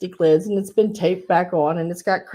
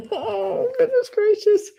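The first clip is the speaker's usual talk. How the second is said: pitched way up, breaking with laughter; high laughing exclamation; slower than usual. Real, not acted. Seller laughing at her own reallys, high squeal. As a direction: squeal it through giggles.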